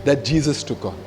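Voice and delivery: ordinary talk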